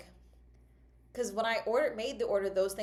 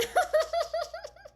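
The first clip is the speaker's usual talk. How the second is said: squealing laugh climbing upward; high rising laugh; 6 dB louder than usual. Real, not acted. Vlogger laughing at Google's summary, high laugh. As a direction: laugh helplessly at the absurdity.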